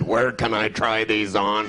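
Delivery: weirdo shopper. Retold or imitated. Comic voicing a weird shopper to the manager, slow and low. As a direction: ask it dead serious and slow. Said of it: "he said".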